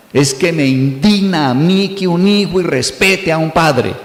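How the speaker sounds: slow, put-on indignant voice; self-righteous indignation. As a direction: play the offended moralist, slowly. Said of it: "esconderse detrás de la pantalla de indignación". (indignación santurrona, lenta y afectada)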